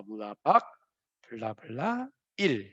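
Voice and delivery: ordinary delivery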